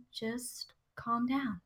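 soft, slow acted reassurance; imitating someone soothing a panicker; calm hushing. Jillian calming a panicking sister.

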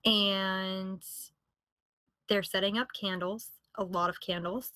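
usual speaking voice

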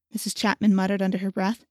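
ordinary delivery

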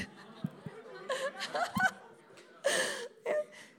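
high, breathless giggle; helpless high laugh; high laughing fit. Real, not acted. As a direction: dissolve into laughter.

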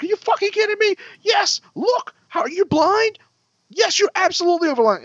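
very high-pitched incredulous rant; retold blow-up, voice shooting up; flipped-out outburst. Driver told he didn't cross the line, flipping out. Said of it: "and I flipped out".

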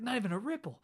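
a giggly put-on pirate marvelling; amazed pirate. Podcaster voicing a pirate to his cohost, high and laughing. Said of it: "He's like".

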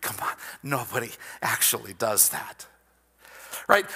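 normal talking voice

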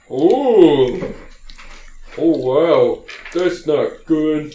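high, loud dim voice, slowing at the end; dismayed oaf. Acted. GM as Elmo reacts to the dead halfling, slow high dismay. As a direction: gawk at the corpse, dragging it out.

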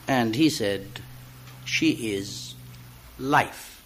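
recited dialogue between two friends; poem reading. Acted. Reader performs a poem of two friends, recital style. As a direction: read it as a staged exchange.

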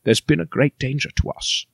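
low, solemn dramatic film actor; Patrick Stewart. Acted. Mentor warning Logan of great danger, solemn impression. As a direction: play it gravely serious. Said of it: "it's Patrick Stewart there".

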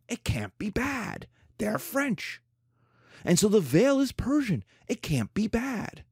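sarcastic refrain. Acted. Sarcastic refrain, high and soft.

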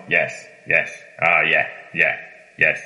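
low, vacant zombie agreement; mock yourself agreeing on autopilot. Newcomer nodding along without understanding, zombie-like mumbled agreement. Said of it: "ahí estaba como zombie diciendo".

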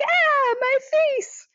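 high-pitched mock scream; exaggerated shriek, imitating her panic; retold scream. Film character wailing about her cut cheek, mock scream. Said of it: "she screams about her face".

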